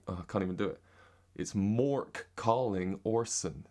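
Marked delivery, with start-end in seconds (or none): 1.5-3.6 slow, careful American accent. Shown as a demonstration. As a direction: overpronounce the American r's, very slowly. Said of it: "in an American accent".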